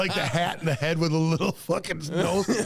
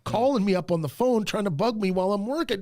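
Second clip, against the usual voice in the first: usual speaking voice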